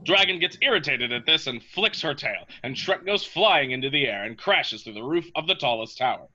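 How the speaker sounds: usual speaking voice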